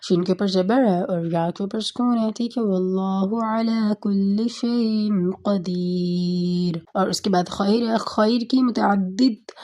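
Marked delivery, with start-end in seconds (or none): 2.6-6.8 very slow chanted recitation; Quran recitation. Shown as a demonstration. Teacher reciting a verse example for students, slow chanted recitation. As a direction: draw out every word like tajweed. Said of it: "یہ بھی خود اپنے لفظ کے اندر یہ مثال ہے".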